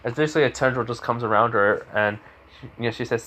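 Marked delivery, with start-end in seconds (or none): none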